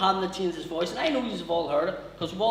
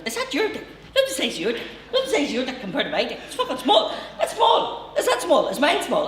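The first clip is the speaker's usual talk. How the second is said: high, loud, over-familiar Newry man; nutty Newry stranger; 7 semitones higher, 5 dB louder than usual. Acted. Newry nutter pestering men in the toilet, high loud manic voice. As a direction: jabber loudly in a high, hammed-up nutter voice. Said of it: "This guy's fucking nuts".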